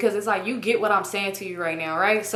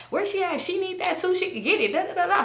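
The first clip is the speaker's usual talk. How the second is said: high mocking voice; 9 semitones higher than usual. Drunk [name] egging on.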